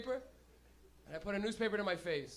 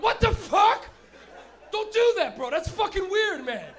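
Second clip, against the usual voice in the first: high, loud laughing yell of disgust; freaked-out shout; 11 semitones higher, 14 dB louder, slower than usual. Retold or imitated. Friend on mushrooms yelling at the fake baby cry, laughing. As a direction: shriek it like a grossed-out friend. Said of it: "And he went".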